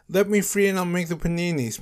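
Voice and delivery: high, loud plea; yelling. A narrator's reading. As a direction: yell out a desperate bargain. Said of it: "Mister Crocodile yelled".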